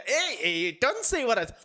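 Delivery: ordinary delivery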